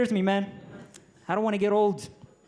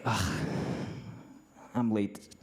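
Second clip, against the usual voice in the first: bored shrug; 7 semitones lower, 5 dB quieter than usual. Retold or imitated. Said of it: "I'm sitting in the back like".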